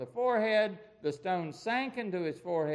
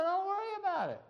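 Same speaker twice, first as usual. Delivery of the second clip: put-on high boyish voice growing louder and bolder; a shepherd boy boasting of the bear and lion, building to a louder finish; young David; 11 semitones higher, 3 dB louder than usual. David assuring Saul he can beat Goliath, high voice building louder. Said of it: "David said".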